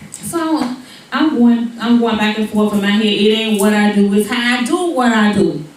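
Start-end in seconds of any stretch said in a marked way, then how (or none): none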